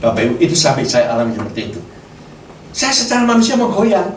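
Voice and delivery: usual speaking voice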